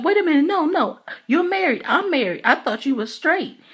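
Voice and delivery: high-pitched, alarmed objection; mimicked startled protest. Retold startled protest at a kiss.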